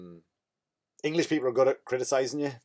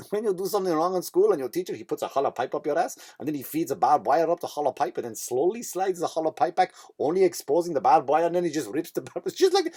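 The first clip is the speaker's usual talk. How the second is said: raised-pitch mock Russian accent; 4 semitones higher than usual. Nurse likens needle to school punishment, mock Russian accent. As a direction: spin a grim tale as a Russian nurse, in accent. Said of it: "here's what I noticed about the Russian accent".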